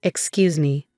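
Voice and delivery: usual speaking voice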